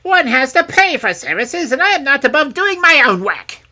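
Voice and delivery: very loud, high, cross crone; cross old hag. A narrator's reading. Hag rejecting the offer of services, crossly. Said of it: "hag crossly".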